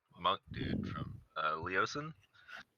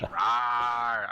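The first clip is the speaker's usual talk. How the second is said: a louder, playful bear rawr; bear growl; 5 semitones higher, 6 dB louder than usual. Pretend. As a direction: give a short goofy bear growl. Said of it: "that's my bear growl".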